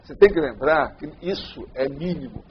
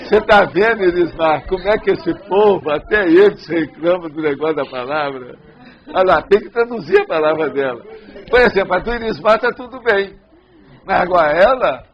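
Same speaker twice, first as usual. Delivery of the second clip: raised, animated protest; loud teasing complaint; 3 semitones higher, 7 dB louder than usual. Real, not acted. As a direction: grumble loudly in jest to the room. Joking complaint about her accent.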